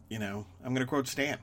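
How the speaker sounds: usual speaking voice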